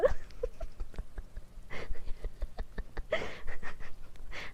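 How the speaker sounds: high-pitched giggling fit; real, helpless giggles; laughing. Real, not acted. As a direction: crack up at your own silliness. Laughs at own odd pronunciation.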